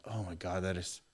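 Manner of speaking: hushed awe. Retold or imitated. Smelling the desert breeze, hushed and awed. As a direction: breathe it out in wonder.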